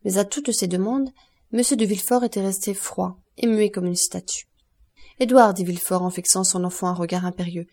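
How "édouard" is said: louder, higher call of the name; stern call. A narrator's reading. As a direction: call the child sternly to order. Father sternly orders his son out.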